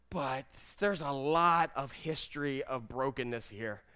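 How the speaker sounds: slow, low, subdued admission; heavy-hearted doubt. Acted. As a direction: drop to a quiet, weighted confession. Acted prayer turning to doubt.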